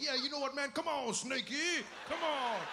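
high-pitched pretend taunting; put-on tough-guy challenge; mock macho bravado. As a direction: egg on the snake, hammed up. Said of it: "my toxic masculinity is not so high".

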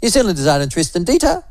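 high, quick mocking imitation; Chris Hipkins. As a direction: parody a politician brushing off the question. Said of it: "Chris Hipkins holds up his hand and he says".

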